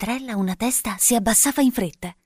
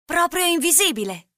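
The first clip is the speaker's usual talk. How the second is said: "Proprio invisibile" as a high, wondering question; high-pitched boyish query on "Proprio invisibile"; intrigued questioning; 10 semitones higher than usual. A narrator's reading. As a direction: ask "Proprio invisibile" high, checking the promise. Boy asks, doubtful and intrigued.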